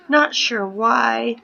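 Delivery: ordinary delivery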